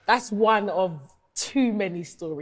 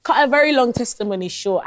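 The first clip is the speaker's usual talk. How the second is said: ordinary delivery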